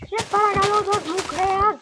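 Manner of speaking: loud, high begging plea; pleading shout. Acted. Begs the pig to hand over its meat, loud high plea. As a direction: beg loudly for the meat.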